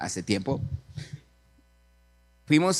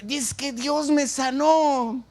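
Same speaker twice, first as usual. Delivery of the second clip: high, slow, drawn-out proclamation; exultant cry; 9 semitones higher, 3 dB louder, much slower than usual. Acted. Second man announcing his healing to the girl, exultant. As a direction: stretch out the good news jubilantly.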